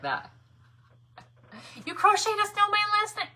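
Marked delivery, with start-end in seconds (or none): 1.9-3.3 much higher, louder, amazed; squealing surprise at the news; delighted surprise. Acted. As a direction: read it like it just thrilled you. Reading a viewer comment aloud.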